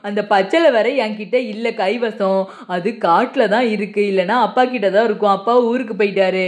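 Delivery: put-on a concerned young healer asking which snake; village healer's son. Healer's son advises hospital.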